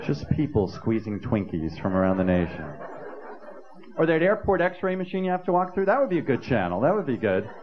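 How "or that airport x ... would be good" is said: ordinary delivery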